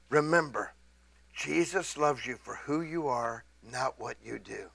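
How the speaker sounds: quiet, tender, almost hushed; soft reassurance. Retold or imitated. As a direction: say it gently to a stranger at the window. Gentle word to drive-thru girl.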